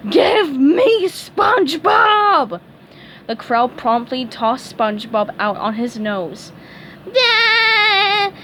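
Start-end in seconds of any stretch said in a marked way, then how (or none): 0.1-2.6 high, loud, very slow shout; angry demand. A narrator's reading. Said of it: "the angry starfish yelled". 7.2-8.3 high, loud squeaky nervous laugh. A narrator's reading. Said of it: "SpongeBob laughed nervously".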